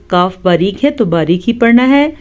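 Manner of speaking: ordinary delivery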